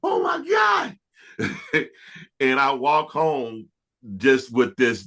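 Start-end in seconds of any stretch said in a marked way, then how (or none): 0.0-1.9 very high-pitched mock scream; retold scream. Singer reenacting his joy at being signed, screaming. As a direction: squeal it, reenacting wild joy. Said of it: "I'm screaming like".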